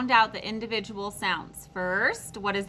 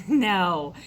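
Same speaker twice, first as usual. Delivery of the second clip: normal talking voice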